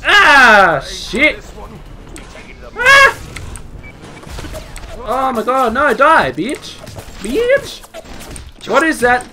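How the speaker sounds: high, loud frantic yells; panicked yelling. Real, not acted. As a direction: yell it out fighting for life. Fighting a sudden enemy.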